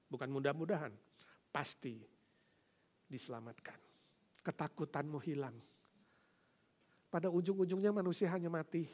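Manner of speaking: ordinary delivery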